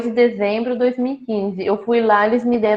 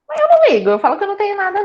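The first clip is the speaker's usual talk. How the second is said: defiant cheer; 10 semitones higher, much faster than usual. Real, not acted. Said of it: "defiant joking about metastasis".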